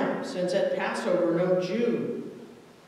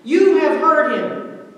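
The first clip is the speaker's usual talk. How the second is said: loud, high cry of outrage; 6 semitones higher, 8 dB louder than usual. A narrator's reading. High priest accusing Jesus of blasphemy, crying out. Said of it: "Caiaphas cried out and tore his fine robes".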